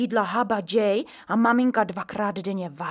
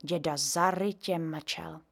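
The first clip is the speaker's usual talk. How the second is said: ordinary talk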